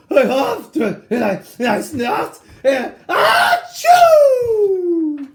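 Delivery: huffing into a loud sneeze; building huge sneeze. Acted. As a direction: huff and snuff, then a big high achoo.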